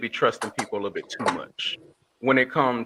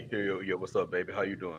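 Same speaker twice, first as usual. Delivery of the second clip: put-on low, quieter smooth-talker voice; mock-deep pickup voice; 5 semitones lower, 10 dB quieter than usual. Shown as a demonstration. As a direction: drop it low like a fake straight boyfriend. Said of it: "I bust out with the deep voice".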